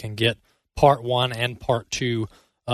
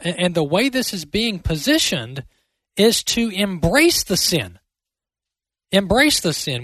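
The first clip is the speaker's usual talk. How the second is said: raised, fervent preaching voice; impassioned preaching; 7 semitones higher than usual. Real, not acted. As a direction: preach it with rising conviction. Host preaches on sin, church.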